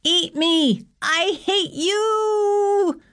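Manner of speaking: high screamed retort, last word held long; furious screaming. A narrator's reading. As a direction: scream it back at him, very high and drawn out. Falling heroine screams back at him.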